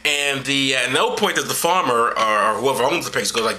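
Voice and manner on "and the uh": ordinary delivery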